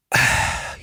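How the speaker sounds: unvoiced breathy sigh letting everything go; collapsing sigh. Shown as a demonstration. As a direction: sag out on a sigh. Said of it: "you just collapse".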